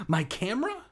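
high shocked question; surprised. Acted. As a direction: gasp it out in disbelief. Shocked discovery.

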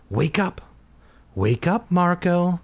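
urging yourself awake, pitch lifting; self-command. A narrator's reading. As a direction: order yourself out of a dream. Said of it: "I ordered myself".

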